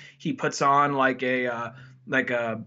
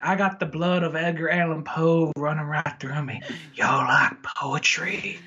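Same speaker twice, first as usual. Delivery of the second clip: put-on Southern accent. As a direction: play along as a Southern gent, stretching the last words. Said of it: "I got my nice southern voice".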